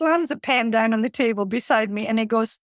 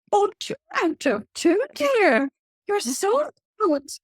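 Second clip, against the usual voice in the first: dripping sarcasm; 11 semitones higher than usual. Retold or imitated. Reluctant attendee mocking the trainer, high dripping sarcasm. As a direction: sneer the compliment in a high sing-song. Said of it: "sarcasm was literally dripping".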